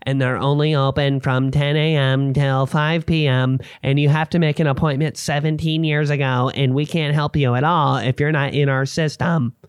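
droning, unreal office-drone voice; mocking inflexible clinic staff in a monotone; mock dentists and doctors. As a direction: recite the rules in one even put-on voice. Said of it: "Dentists and doctors work like this".

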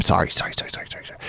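whispered sorrys. Retold or imitated. Mumbled apologies to student.

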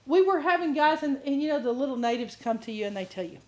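ordinary talk

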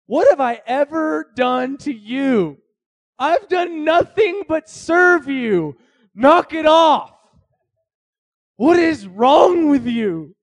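high, loud, slow wounded protest; pleading donkey. Acted. Donkey protesting to its master who beats it, slow loud wail. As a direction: shout it like a hurt, wronged animal. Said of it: "the donkey talks and says".

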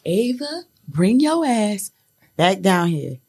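put-on a stern mother calling her child back down, slow and firm; stern mom call. Mom calling daughter back downstairs.

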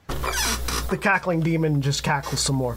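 ordinary talk, nothing marked

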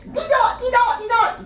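very high British-style syllable chant; really high. Shown as a demonstration. Teacher shows Harry Potter-fan kids the chant, really high. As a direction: chant it way up in head voice. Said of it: "Will you say really high".

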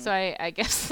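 ordinary talk

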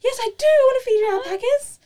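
high, loud, gushing acceptance; eager yes. Retold or imitated. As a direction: jump at the offer, squealing with eagerness. Retelling her eager acceptance of offer.

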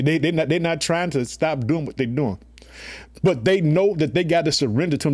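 ordinary delivery

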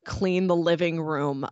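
usual speaking voice

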